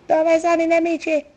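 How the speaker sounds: shrill frantic question, high and loud; excited high shout. Real, not acted. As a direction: call it out in a panic.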